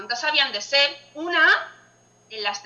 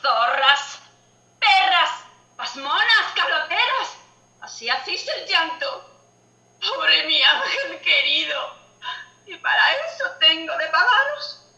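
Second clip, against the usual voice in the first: angry shouting; 5 dB louder than usual. Acted. Widow scolding the mourners.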